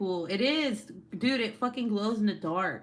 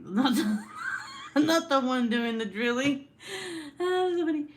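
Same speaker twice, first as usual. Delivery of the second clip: louder and higher, words bursting through laughter; laughing; 8 semitones higher, 5 dB louder than usual. Real, not acted. Streamer cracking up at her drilling joke, laughing shout. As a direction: laugh out the correction.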